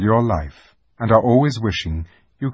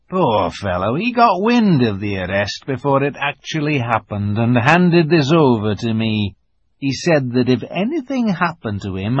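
lisping buck-toothed beaver; 4 semitones higher, 3 dB louder than usual. Acted. Beaver voiced by narrator.

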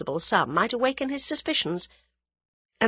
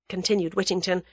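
ordinary delivery

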